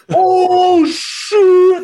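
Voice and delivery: high loud mock gasp of shock. Teammates reacting to the lotion offer, high loud shock. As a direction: yelp it like you can't believe it.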